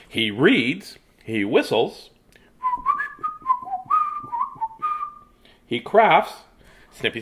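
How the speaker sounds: storytime read-aloud with dramatic pauses; reading to children. Acted. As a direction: read it slowly to a room of kids. Reads picture book aloud.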